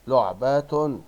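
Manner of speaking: "لعبة" said loudly as "lu'-ba-tun"; loud teacher's model of لعبة with nunation; tanween damma ending. Shown as a demonstration. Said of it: "تنوين بالضم أن".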